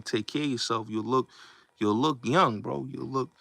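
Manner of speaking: ordinary delivery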